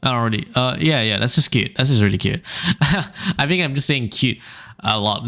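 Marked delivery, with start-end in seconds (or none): none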